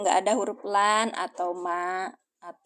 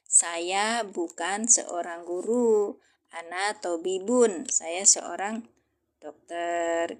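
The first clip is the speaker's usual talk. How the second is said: very slow, each word laid out; slow translation; 2 semitones lower, much slower than usual. Shown as a demonstration. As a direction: translate it slowly so they follow. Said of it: "ana lastu bimudarisin". (terjemahan diucapkan sangat pelan)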